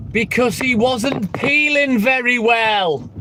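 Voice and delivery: milk the pun slowly; deliberate comic-timing delivery; slow punchline. Acted.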